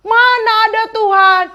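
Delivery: high, loud mocking scoff; hammed-up defiance; defiant scoff. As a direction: scoff like a rebel. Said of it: "Misalnya mereka begini".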